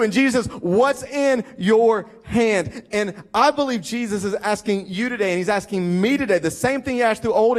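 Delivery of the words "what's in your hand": "What's in your hand?" very slow, word by word; higher, "What's in your hand?" with each word stressed; weighty, spaced-out question. Retold or imitated. Preacher voices Jesus' pointed question.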